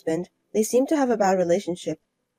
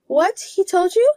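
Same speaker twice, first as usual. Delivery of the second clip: startled rival wife; 10 semitones higher, 4 dB louder than usual. Acted.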